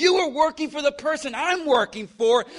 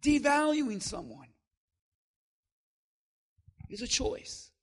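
much softer, intimate preaching; 7 dB quieter than usual. Acted. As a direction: pull them in, drop the volume. Sudden drop to soft delivery.

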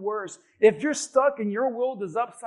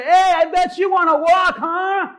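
higher, louder, teasing jab; mock insensitive taunt; 6 semitones higher, 7 dB louder than usual. Pastor mocking a crippled man from a car, snide. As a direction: tease him like a careless jerk. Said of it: "and I say".

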